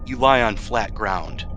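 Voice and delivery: normal talking voice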